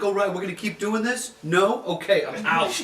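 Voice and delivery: lightly voiced fighter bowing out; cocky boxer. Boxer guy giving up on the kangaroo, mock cocky voice. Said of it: "is like".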